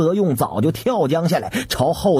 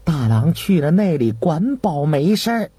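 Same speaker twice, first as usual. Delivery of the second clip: ordinary delivery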